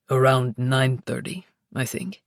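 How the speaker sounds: deep teasing lover; low playful male voice. Acted. Luca voiced.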